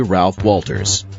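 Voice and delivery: ordinary talk, nothing marked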